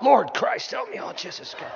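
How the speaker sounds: mock groaning prayer. Comedian mimicking bending down, hammed-up groan. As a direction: moan it as you bend down. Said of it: "You ever go to pick something up?".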